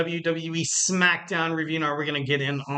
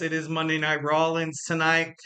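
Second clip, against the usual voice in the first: ordinary talk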